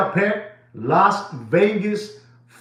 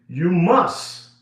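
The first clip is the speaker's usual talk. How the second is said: normal talking voice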